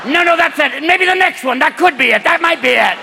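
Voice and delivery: put-on high, loud rambling old-timer; an old man giving directions, getting lost in memories; old-man GPS. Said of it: "the car's going".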